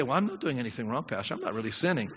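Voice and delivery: low, quiet, offhand excuse; lightly voiced, low-key protest of innocence; self-excusing. Churchgoer excusing himself to the Pastor, low and dismissive.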